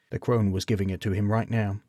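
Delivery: ordinary delivery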